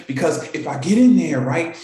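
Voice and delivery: ordinary talk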